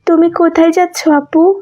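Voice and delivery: a younger sister anxiously asking about her sick father; younger sister's voice. Acted. Younger sister asking her elder sister, anxious and high. Narrator voices Saima again.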